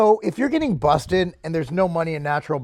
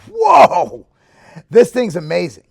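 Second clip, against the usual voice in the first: blurting out delight at the taste; excited whoa; 7 dB louder, slower than usual. Retold or imitated. Trying the new protein powder, loud amazed whoa. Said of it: "And I was like".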